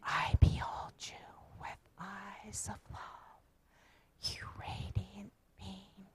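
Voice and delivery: slow, breathy group whisper; soft slow whisper, no voice. Shown as a demonstration. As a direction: whisper it across the room like a secret blessing. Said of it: "Now we're gonna whisper it".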